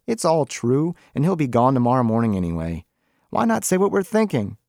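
a high, insistent wife cutting in; Rachel, pushing back. A narrator's reading.

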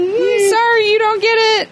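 mock dismissive voice. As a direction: imitate a smug staffer turning people away. Mocking event staff brushing off kids.

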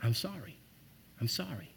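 hushed condolence to a grieving friend; soft condolence. Retold or imitated. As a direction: offer quiet sympathy. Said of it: "the number one thing that we probably would have said".

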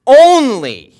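"Only." punched out loud and high, falling hard; bold, exclusive "Only."; emphatic only. Acted. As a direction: slam down on "Only" like an exclamation point. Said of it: "it is emphatic".

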